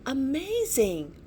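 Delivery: high excited exclamation; amazed. A narrator's reading. Small Edmontonia amazed by Archie, high excited exclamation. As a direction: burst out in wonder.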